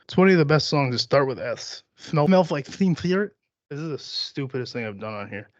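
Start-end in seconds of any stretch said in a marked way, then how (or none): 2.0-3.3 higher, slow, slushy lisp on the S's; Daffy Duck lisp. Pretend. Host trying out an S song, Daffy Duck lisp. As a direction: lisp "Smells" and "Spirit" like a cartoon duck. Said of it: "Let's Daffy Duck this shit".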